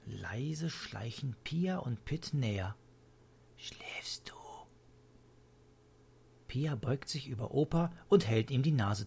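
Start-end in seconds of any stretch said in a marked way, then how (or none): 3.6-4.4 soft, cautious whisper; hushed whispered question. A narrator's reading. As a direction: whisper to check if he is asleep. Children creep up to sleeping grandpa.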